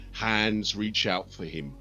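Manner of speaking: ordinary delivery, nothing marked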